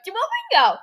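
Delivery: high, loud imitation of a clueless friend; mock dumb voice. Girl retelling her friend still being dumb, mocking high voice. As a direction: blurt it out high and silly, making fun of her. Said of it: "still being dumb".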